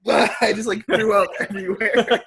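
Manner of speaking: laughing. Real, not acted. Teller recounting throwing up to friends, laughing. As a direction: crack up while telling it. Laughs while telling his vomiting story.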